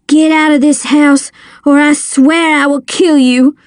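tense threat. A narrator's reading. Boy aiming a shotgun at his father, threatening. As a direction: warn him off, meaning it.

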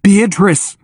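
high, emotional cry; choked with emotion. A narrator's reading. Brad crying out to his dog in labor, choked up. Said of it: "his voice choked with emotion".

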